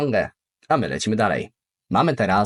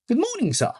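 example greeting. Shown as a demonstration. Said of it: "Na przykład".

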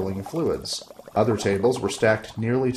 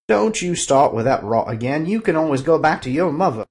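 louder snapping little sorcerer; irritated wizard; 6 semitones higher, 6 dB louder than usual. Acted. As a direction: bicker back at wife. Fezzer snaps back.

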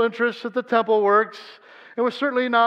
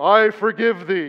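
mock pronouncement; 6 dB louder than usual. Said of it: "I can't walk up to you and go".

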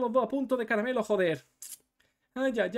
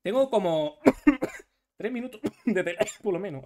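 ordinary delivery

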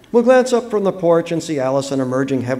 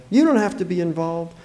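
raised pitch, easy offer; reassuring; 5 semitones higher than usual. Acted. Neighbour freeing the father of duty, reassuring. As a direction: let him off the hook. Neighbour's first saying, later echoed.

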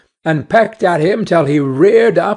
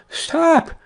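high, urgent warning from a little sparrow; high-pitched storybook cry; 7 semitones higher than usual. A narrator's reading. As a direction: call out a warning, pitched well up. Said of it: "cried out".

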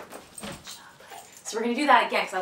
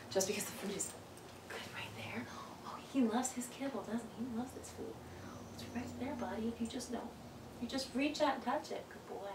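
hushed whisper, coaxing the dog; soft whispered praise to a hesitant dog; 2 semitones higher, 15 dB quieter than usual. Real, not acted. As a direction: murmur encouragement under your breath. Soft coaxing of dog near food.

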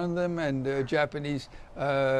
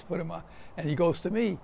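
ordinary talk

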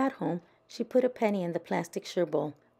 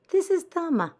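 put-on high, louder friendly girl voice; Thelma on the phone; 13 semitones higher, 7 dB louder than usual. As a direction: announce yourself brightly.

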